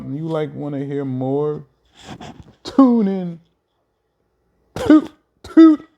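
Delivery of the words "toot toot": high and loud, like a toy horn blast; tooting horn call. Pretend. A host plugging his show to listeners, with a high, loud toot. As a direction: toot it out like a little trumpet.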